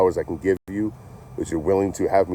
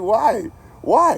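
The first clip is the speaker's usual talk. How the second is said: much higher, louder disbelieving why; stunned, laughing disbelief at the table; incredulous why; 6 dB louder than usual. Retold or imitated. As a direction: squeal it out in disbelief. Baffled at the blue pills.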